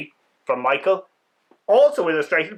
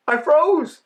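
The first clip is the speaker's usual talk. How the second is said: higher, breathless-sounding thrill; frozen with excitement; 11 semitones higher, 4 dB louder than usual. A narrator's reading. The bird on the windshield freezes in excitement, high and thrilled. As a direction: squeak it out, stunned by the thrill. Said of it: "and in all my excitement".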